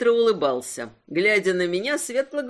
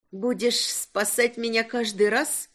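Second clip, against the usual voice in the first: usual speaking voice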